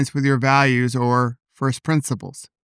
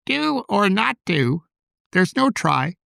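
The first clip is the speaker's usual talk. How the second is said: slow, higher-pitched bad impression of the little green sage; hammed-up sage voice, drawn out and pitched up; horrible Yoda; 6 semitones higher than usual. Little voice in the speaker's head after a crash, horrible Yoda impression. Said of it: "that horrible Yoda impression".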